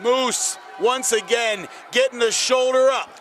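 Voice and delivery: excited near-fall call. Real, not acted.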